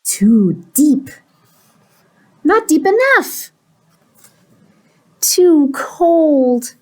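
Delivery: exaggerated complaints, swinging high and loud; fussy pigeon. Pigeon finding fault with every bath, fussy outbursts. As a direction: reject each bath with growing drama.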